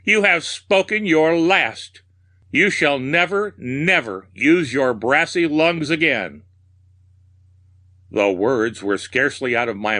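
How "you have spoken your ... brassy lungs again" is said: high, hateful storybook rant; theatrical frenzy of hate; frenzied shrieking. A narrator's reading. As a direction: shriek a triumphant threat, pitched high. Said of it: "I shrieked, brandishing my hatchet and dancing in a frenzy".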